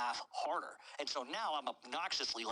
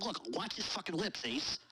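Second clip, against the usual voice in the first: excited retort; 6 semitones higher than usual. Retold or imitated. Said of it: "And I said".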